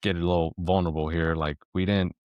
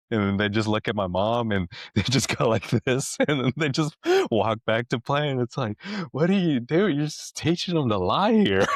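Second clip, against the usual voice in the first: higher-pitched, amused telling through laughter; laughing while telling; 6 semitones higher, 4 dB louder than usual. Real, not acted. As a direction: tell it cracking up, pitch climbing into mock scolding. Laughing at own anecdote.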